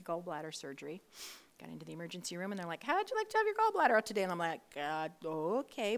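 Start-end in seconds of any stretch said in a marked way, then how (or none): 2.8-4.2 high, fast, loud mock sales pitch; chirpy hospital staff. Imitating breezy emergency room staff. 4.8-6.0 slow, drawn-out reluctant okay; hesitant agreement. Retold or imitated. Her hesitant answer to the offer.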